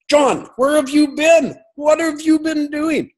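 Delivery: very high, excited exclamation; imitated delighted greeting. Doctor recognising an old acquaintance on the phone, imitated excitement.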